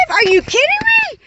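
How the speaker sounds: explosive "are you kidding me"; expressive outburst. Real, not acted. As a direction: burst out in disbelief. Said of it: "I said I'm gonna get expressive".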